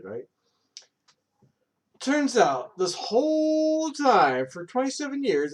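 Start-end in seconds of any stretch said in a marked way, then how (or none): 2.0-5.5 dramatic reveal. Real, not acted. Dramatic reveal about sprouts.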